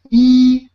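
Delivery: "yi" held long on a high, level pitch; high first tone. Shown as a demonstration. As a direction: draw out "yi" high and steady. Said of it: "It's a high pitch".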